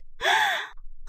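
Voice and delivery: sharp high intake of breath; shocked gasp. A narrator's reading. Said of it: "شهد بصدمة".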